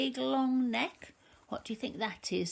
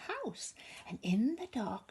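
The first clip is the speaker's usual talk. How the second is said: whisper; 4 dB quieter than usual. A narrator's reading. Spooky rhyme hush.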